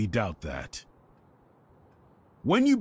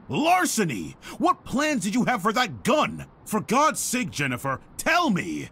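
angry scolding; 8 semitones higher than usual. Acted. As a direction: scold her, building to a demand. Headmaster scolds the girl.

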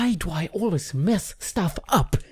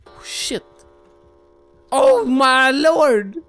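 ordinary delivery

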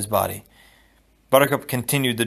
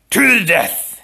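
loud, higher duel challenge; 9 semitones higher, 7 dB louder, slower than usual. Acted. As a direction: throw down the challenge to fight. Prince challenging with a sword.

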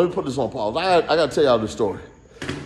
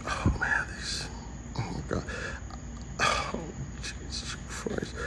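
whispered; 2 semitones lower, 13 dB quieter than usual. Real, not acted. Whispering to self.

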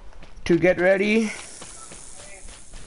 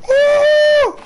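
loud, high whoop of triumph; excited cheer; 5 dB louder than usual. Real, not acted. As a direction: cheer at the top of your lungs. Cheering after a win.

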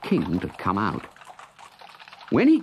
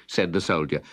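ordinary delivery, nothing marked

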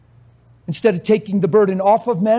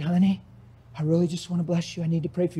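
hushed, gentle plea; softly offering to pray for the shaken lady; soft and coaxing; 2 semitones lower, 7 dB quieter, faster than usual. Retold or imitated. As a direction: quietly coax her into prayer.

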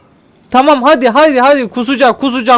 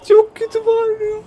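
much higher, softer squeal mimicking the scream; squeaky mock scream voice; 11 semitones higher, 4 dB quieter than usual. (çığlığı taklit eden ince ses)

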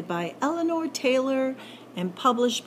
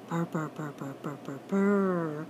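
low, soft rolling purr; purring cat; 6 semitones lower, 5 dB quieter than usual. Acted. Narrator imitating the cat's purr, low and soft.

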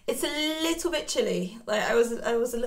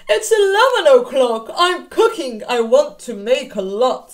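louder, lightly voiced wolf; busy Mister Wolf; 9 dB louder than usual. Wolf telling the children about his big pot, louder. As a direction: boom it out as a busy cook wolf.

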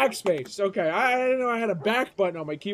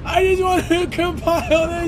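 high, loud, hammed-up whine; mock despairing wail; 5 semitones higher, 5 dB louder than usual. Streamer wanting to compile shaders, mock despairing wail. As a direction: wail it as if all is lost.

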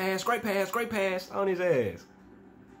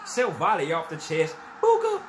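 jumping up to celebrate a goal; goal celebration yell; 4 semitones higher, slower than usual. Real, not acted. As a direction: yell it out as the ball goes in. Erupts at goal.

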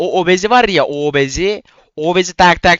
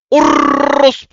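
battle cry; 12 semitones higher, 5 dB louder than usual. Real, not acted. Growl-like cry during attack.

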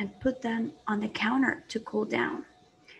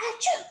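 a big sudden sneeze. Acted. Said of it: "Credence went".

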